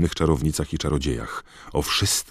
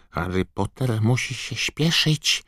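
high, squeaky elf urging; croaking house-elf; 9 semitones higher than usual. A narrator's reading. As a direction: squawk like an urgent house-elf. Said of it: "zaskrzeczał Zgredek". (skrzeczący, wysoki głos skrzata)

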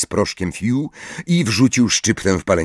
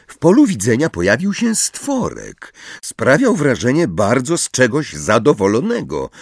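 ordinary delivery, nothing marked

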